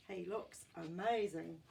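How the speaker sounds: ordinary delivery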